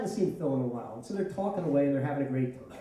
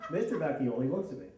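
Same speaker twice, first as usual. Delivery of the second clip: ordinary talk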